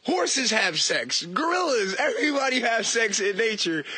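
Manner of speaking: normal talking voice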